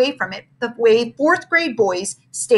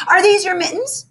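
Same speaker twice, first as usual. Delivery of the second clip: eager cry; 9 semitones higher, 3 dB louder than usual. A narrator's reading. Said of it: "suddenly cried".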